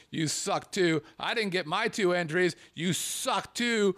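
hammed-up angry viewer rant; mock angry rant. Reading angry viewer letter.

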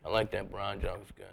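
very soft, low deadpan drone; Stephen Wright impression. Comic imitates Stephen Wright on stage, soft low monotone. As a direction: barely lift the voice, monotone and sleepy. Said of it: "You talk to him on stage".

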